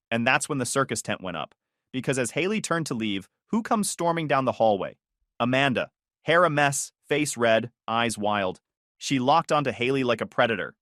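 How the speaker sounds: plain reading voice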